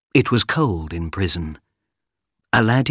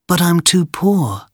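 put-on young boy voice; 9 semitones higher than usual. Boy Aladdin voiced in story.

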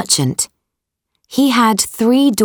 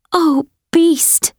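put-on high, pleading young woman; pleading girl voice; 9 semitones higher than usual. A narrator's reading. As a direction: beg him, pitched high. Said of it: "she said".

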